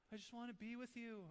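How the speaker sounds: sweetly mimicked young son; four-year-old child. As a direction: imitate a small child pleading gently. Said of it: "My four-year-old comes around".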